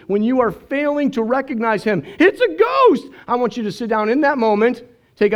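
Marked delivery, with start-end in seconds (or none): 2.2-3.1 high frightened shout; hammed-up ghost scare; mock fright. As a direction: cry it out like you've seen a ghost. Preacher mimics frightened disciples.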